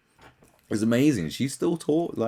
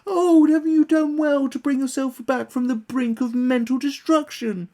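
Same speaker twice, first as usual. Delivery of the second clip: very high, louder mock praise; sarcastic fawning; 6 dB louder than usual. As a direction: gush with false admiration. Mocking fans' praise.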